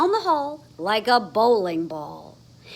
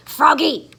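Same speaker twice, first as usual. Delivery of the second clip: loud stern call; teacher cries; 6 semitones higher, 10 dB louder than usual. Acted. Miss Witherspoon calling Froggy, loud cry. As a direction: call him to order. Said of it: "cried his teacher".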